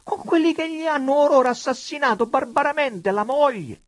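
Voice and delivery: high, loud outcry of dismay; appalled high-pitched protest; astonished, distressed. A narrator's reading. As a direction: exclaim in pained disbelief. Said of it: "esclamò con stupore e afflizione monsignore".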